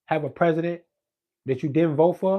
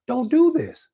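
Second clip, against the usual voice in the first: raised plea; 11 semitones higher, 6 dB louder, much slower than usual. Real, not acted. Angry rant pleading with government.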